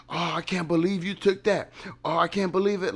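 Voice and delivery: high, put-on voice of disbelief; mock outraged commenters. Vlogger imitating viewers' comments, high mock outrage. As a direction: mimic scandalized viewers, pitched well up. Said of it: "some of you say in the comment".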